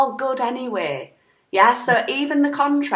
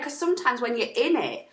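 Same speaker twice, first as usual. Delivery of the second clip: ordinary talk, nothing marked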